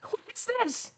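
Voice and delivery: very high, quiet squeaky critter voice; the bear asking what this is, in a tiny high voice; curious bear. Pretend. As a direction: play the bear sniffing a body. Voicing bear sniffing you.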